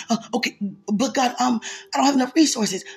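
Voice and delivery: stammering believer. Retold or imitated. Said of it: "Many of us say".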